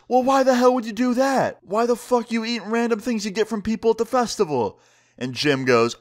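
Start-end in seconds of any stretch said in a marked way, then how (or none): 0.1-4.8 high-pitched, incredulous rant; acted-out exasperated outburst, pitched way up; exasperated scolding. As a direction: scold him in outraged disbelief. Guard scolds Jim in acted quarrel.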